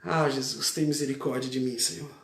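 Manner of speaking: low, soft plea for mercy; hushed, humble cry to Jesus; heartfelt plea. Shown as a demonstration. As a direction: pray it quietly from the heart, sinking away. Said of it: "tem alguém pra clamar a Jesus aí?".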